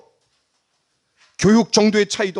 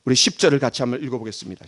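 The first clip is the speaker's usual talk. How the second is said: normal talking voice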